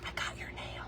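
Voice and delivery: hushed whisper. Retold or imitated. Friend whispering that she found the nail. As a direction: whisper it like a secret. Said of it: "she's like".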